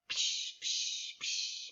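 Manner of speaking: soft hissing fireworks sound; firework noises. Shown as a demonstration. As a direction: puff out "psh" like fireworks. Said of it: "Let's do some fireworks".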